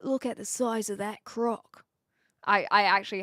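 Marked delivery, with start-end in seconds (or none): 0.0-1.8 lightly attempted Aussie accent; half-hearted Aussie wildlife-host impression; Steve Irwin. Shown as a demonstration. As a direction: marvel like an Aussie croc wrangler. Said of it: "Um, Steve Irwin".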